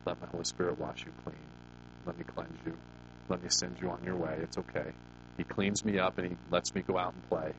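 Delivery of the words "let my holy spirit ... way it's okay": reassuring God. Retold or imitated. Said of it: "He reminds me".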